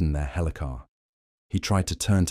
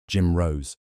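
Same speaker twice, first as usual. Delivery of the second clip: plain reading voice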